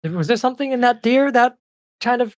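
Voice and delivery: high, loud, rushed fairy voice; anxious little fairy; worried ice fairy. Acted. Fairy worries about warden.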